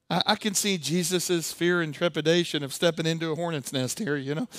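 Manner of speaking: ordinary talk, nothing marked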